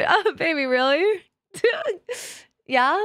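normal talking voice

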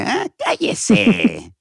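high, drawn-out dismissive character voice; Armando Hoyos. Acted. Character host belittling a guest, high and drawn out. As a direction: stretch it into a haughty shushing. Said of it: "Los pendejeaba así de".

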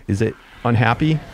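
ordinary delivery

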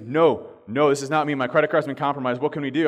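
panicking. Retold or imitated. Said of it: "he's panicking a little bit".

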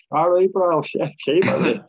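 high, loud greeting with a laugh; mock-hearty shout of encouragement; jokey retold call-out. Retells calling out to passer-by.

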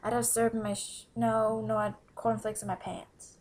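usual speaking voice

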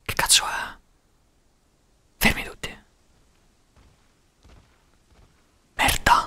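hushed, scared whisper. Real, not acted. Streamer faces a mysterious Pokémon in fog, hushed whisper. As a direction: whisper like something might hear you. Spooky legendary appears in fog.

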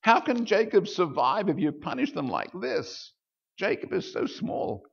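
high, loud pleading cry to the Lord; crying out to God. Retold or imitated. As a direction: beg desperately for the people. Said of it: "I cried out".

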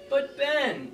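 high, whining plea; whiny little voice. Pretend. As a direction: whine it like a lazy student. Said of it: "you say in a whiny little voice".

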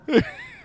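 shrill burst of laughter; high squeal laugh. Real, not acted. Friend's joke.

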